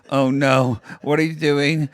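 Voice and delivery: ordinary talk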